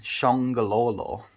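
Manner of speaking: shongololo drawn out slowly and savoured; shongololo, slow and playful. Shown as a demonstration. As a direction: roll shongololo slowly round the mouth. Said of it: "It's fun to say".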